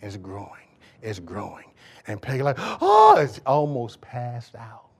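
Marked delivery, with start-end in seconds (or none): 2.6-3.2 high, loud "oh" of alarm; mock horrified gasp. Imitating his wife seeing the growing lump, horrified cry. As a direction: imitate a mother about to faint. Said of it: "she almost completely lost it".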